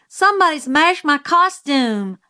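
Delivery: high, loud wail of dismay. A narrator's reading. Scout finding her costume mashed, wailing in dismay. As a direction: wail it out in alarm. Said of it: "I wailed in dismay".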